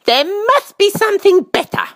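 put-on high, complaining little-girl voice; high-pitched child character; little princess. A narrator's reading. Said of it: "said the little princess".

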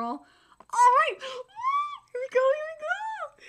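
high, hyped-up squealing; excited squeal. Real, not acted. Reader braces for the manga fight, giddy squeal. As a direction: burst out loud, then squeak with anticipation.

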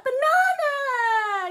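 high loud slow puppet shriek of banana; squeaky puppet cry. Pretend. Sock puppet cheering the answer, high and loud. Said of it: "What do you think Sockie's got?".

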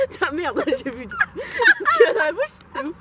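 laughing. Real, not acted. Tasting gross jelly beans, laughing.

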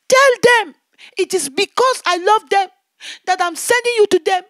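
loud, high commanding divine voice; the Lord commanding his daughter, loud and high. Retold or imitated. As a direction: boom it out as God sending her. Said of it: "He said, "My daughter".

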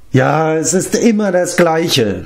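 slow, high, self-pitying wail; sobbing lament. A narrator's reading. Neglected giant complaining to his visitors, sobbing theatrically. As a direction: draw it out as a mock sob. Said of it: "schluchzte er".

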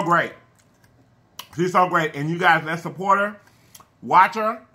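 talking while eating salad; words muffled by food; mouth full, chewing. Real, not acted. Seen 0: Forks pasta salad into mouth at 2.6s, chews through the labelled words.

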